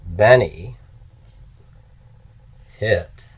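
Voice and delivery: each word slow and spaced out for dictation. Shown as a demonstration. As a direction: dictate it one word at a time. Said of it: "here's a little sentence".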